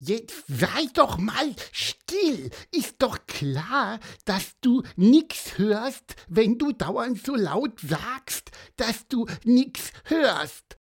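high, slow, nagging scolding; Pieper grumbling. A narrator's reading. Pieper telling off his friend, grumpy and high. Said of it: "motzte Pieper seinen Freund an".

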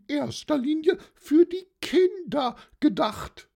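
very high-pitched aunt setting a nephew straight; high, emphatic auntie rebuttal; old aunt, playfully indignant. Acted. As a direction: protest fondly way up high.

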